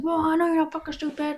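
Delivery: high-pitched, irritated quote; mad and pissy. Retold or imitated. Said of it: "he got all mad and pissy".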